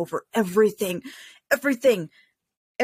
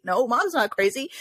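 usual speaking voice

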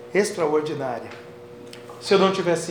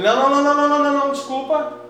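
higher-pitched string of hurried objections; protesting refusal; 7 semitones higher, 7 dB louder, much faster than usual. Retold or imitated. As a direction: act out a frantic protest. Encena recusa a Jesus. (recusa aflita e encenada)

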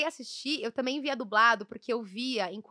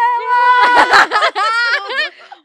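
a loud, high burst of laughter that breaks into a shout; big laughing shriek; 12 semitones higher, 18 dB louder than usual. Real, not acted. Cracking up while telling friends about the Stella scene, shrieking with laughter. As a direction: let the laugh explode out.

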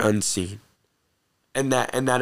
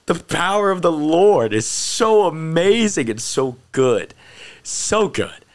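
awed praise; 5 semitones higher, slower than usual. Real, not acted. Overjoyed praise of God's power.

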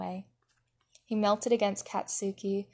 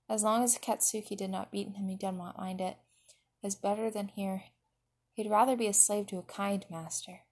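usual speaking voice

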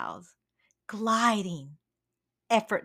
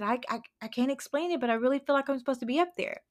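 hurried eagle; 4 semitones higher, much faster than usual. Retold or imitated. Eagle blurts his feeling.

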